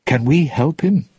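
plain reading voice

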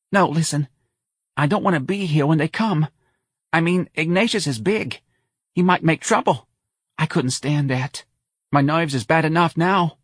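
high, fretting mother; Mrs. Riley, nervous. A narrator's reading. Mother dreading the hospital people coming, high and fretful.

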